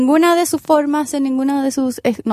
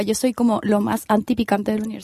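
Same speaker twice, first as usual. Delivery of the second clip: ordinary talk, nothing marked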